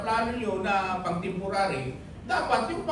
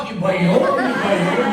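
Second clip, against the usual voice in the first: louder, repeating it with a laugh; emphatic loud laughing; 10 dB louder than usual. Real, not acted. Preacher to congregation, a loud, laughing insistence. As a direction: drive the point home, laughing.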